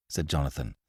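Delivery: usual speaking voice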